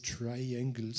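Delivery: Triangles said the English way, TRY-ang-gles, slowly; careful English Triangles, stress on the first syllable, a French accent showing; English pronunciation. Shown as a demonstration. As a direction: Triangles in English, slow and a little hesitant. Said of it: "on devrait le prononcer à l'anglaise".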